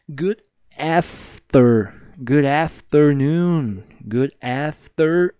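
good afternoon stretched very slow; slow drill. Shown as a demonstration. Teacher breaking down the hardest greeting, slow. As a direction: draw out every syllable of afternoon. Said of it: "a mais chata de todas".

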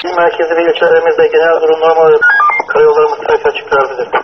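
police radio. Real, not acted. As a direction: report read over a tinny radio. Status report over radio.

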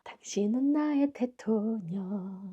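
soft, earnest closing address; quiet mock-solemn thanks; solemn tribute. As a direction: speak a hushed dedication to your hero.

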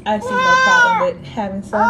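put-on childlike voice. Mother voices child's wish.

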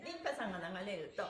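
normal talking voice

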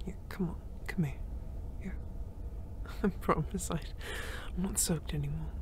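soft, voiceless, intimate whisper; tender whisper. Acted. Grateful friend thanking the rescuer for her mother's ring, tender whisper. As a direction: whisper heartfelt thanks up close.

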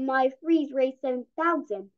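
plain reading voice

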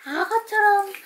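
bright high-pitched cue to open wide; high sing-song. Shown as a demonstration. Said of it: "아".

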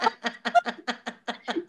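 soft breathy laugh after the story; real laugh trailing off quietly. Real, not acted. Telling her sister about the crying kids, soft laugh. Laughing over the kids' chaos.

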